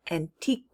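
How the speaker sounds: ANTIQUE wrongly ending "an-TEEK-wuh"; mispronounced with qu. Shown as a demonstration. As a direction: add a "kwuh" to the end of ANTIQUE. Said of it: "It just makes the k sound".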